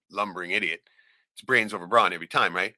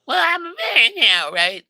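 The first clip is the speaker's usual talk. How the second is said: mocking girl's voice; 9 dB louder than usual. Niece's voice mimicked in the story.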